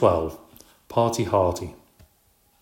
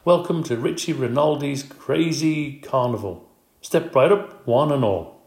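louder, higher showman's call; carnival barker; 5 semitones higher, 4 dB louder than usual. Acted. Aldo in a top hat greeting the party guests, as a booming carnival barker. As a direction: step right up, play the ringmaster.

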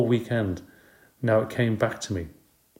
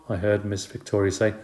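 usual speaking voice

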